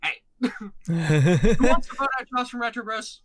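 loud, drawn-out mock announcement; rowdy call-out. Bickering hosts suddenly turn on their friend together, rowdy shout. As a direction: shout it out like rallying a crowd.

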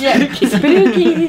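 mock spooky. Joking about elf spirit.

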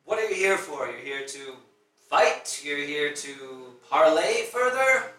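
loud villain interrogating intruders; yelling. Acted. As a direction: demand answers loudly, drawing out the last one. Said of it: "he's yelling".

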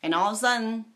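ordinary talk

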